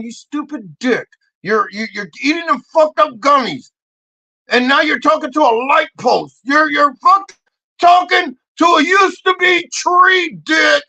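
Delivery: building to a high, loud rant; retold scolding. Comic retelling his telling-off of Santi, mounting exasperation. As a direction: start scolding, climb into a comic shout.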